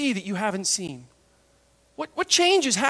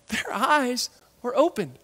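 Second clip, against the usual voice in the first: ordinary talk, nothing marked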